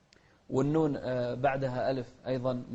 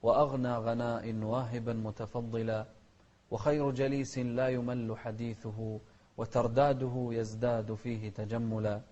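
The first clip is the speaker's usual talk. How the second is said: poem recital; 4 semitones lower than usual. Shown as a demonstration. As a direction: recite the verse gently.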